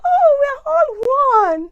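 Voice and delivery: high-pitched, drawn-out mock innocence; naive newcomer. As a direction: sing out imitated wide-eyed friendliness, very high. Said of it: "I was so innocent".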